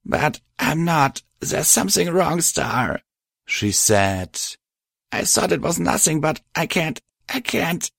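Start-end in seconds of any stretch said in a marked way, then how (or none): 0.0-3.0 high, halting, tearful voice; choking back tears. A narrator's reading. Crying mare confides that something is wrong, tearful. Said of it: "Patch choked on her tears". 5.1-7.9 high voice, faltering and fading; on the verge of tears. A narrator's reading. Crying mare breaks down confiding in her friend, tearful. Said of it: "She broke down again into tears".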